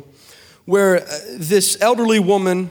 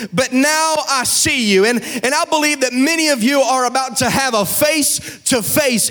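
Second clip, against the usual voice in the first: higher, sung-out preaching over music; full-force preaching; 6 semitones higher than usual. Acted. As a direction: lift the church, chant it like a climax.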